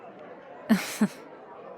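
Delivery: a wistful little laugh. Acted. Amused at a private thought.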